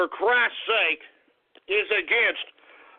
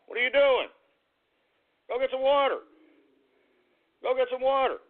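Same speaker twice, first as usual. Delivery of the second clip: higher, coaxing voice for the dog; coaxing pet voice; 4 semitones higher than usual. Real, not acted. Host coaxing his overheated dog to drink, in a higher coaxing voice. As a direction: coax the dog like a worried owner.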